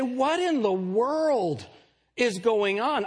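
high, slow, drawn-out disbelief; exasperated. Acted. Preacher voicing people looking out at the world, exasperated. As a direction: stretch it out in high-pitched exasperation.

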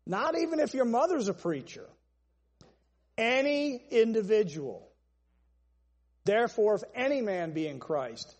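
normal talking voice

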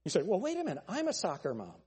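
normal talking voice

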